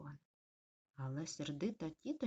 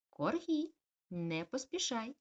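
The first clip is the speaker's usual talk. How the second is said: mild mock call; 6 semitones higher, 4 dB louder, slower than usual. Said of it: "гукала мама".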